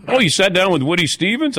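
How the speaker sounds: high, rising impressed question; mock amazement. Racing insiders hearing his name-drop, mock amazement. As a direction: imitate someone wowed by a name-drop.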